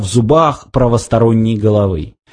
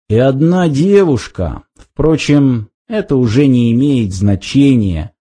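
usual speaking voice

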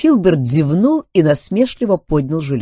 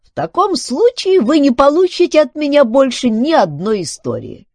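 high, louder robot ultimatum; offended robot; 14 semitones higher, 6 dB louder than usual. Acted. Filbert withholds stories from Duncan, defiant. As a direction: refuse as the robot, pitched way up.

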